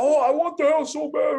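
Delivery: high, sing-song mock of a clueless buyer. Mocking the buyer's eager reply.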